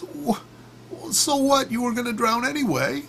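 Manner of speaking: very high squeaky retort; drowning frog. Acted. Drowning frog dismissing the scorpion's complaint, squeakily. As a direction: squeak back in a cartoon falsetto.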